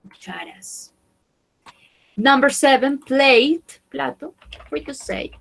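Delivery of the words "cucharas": cucharas whispered. Shown as a demonstration. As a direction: breathe cucharas out softly. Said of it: "modelling words to a class".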